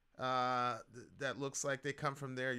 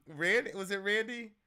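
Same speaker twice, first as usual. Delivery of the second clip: ordinary delivery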